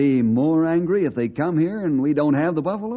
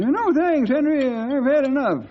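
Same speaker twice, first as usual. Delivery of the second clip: put-on high-pitched old cowhand, polite; old sidekick voice; 13 semitones higher than usual. Sidekick turning down coffee from the cook, high old cowhand voice.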